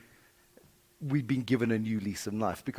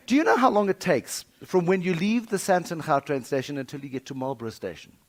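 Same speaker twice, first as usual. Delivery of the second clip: ordinary delivery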